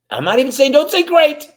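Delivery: high, loud emphatic burst; excited. Real, not acted. Urging the audience to rethink, excited and loud. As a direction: push it up big and loud. Building to a big point.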